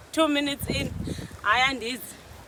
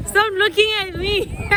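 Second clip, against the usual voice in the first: playful scolding; 11 semitones higher than usual. Real, not acted. Telling off a passer-by, laughing.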